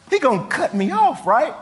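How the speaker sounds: high, loud mock panic; hammed-up frightened queen; panicked protest. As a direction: Esther squealing in alarm, half playful. Esther voiced protesting.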